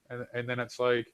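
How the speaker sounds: usual speaking voice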